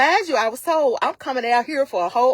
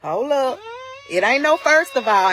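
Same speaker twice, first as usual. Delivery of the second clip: heated retort; 4 semitones higher than usual. Retold or imitated. Heated retelling of her retort.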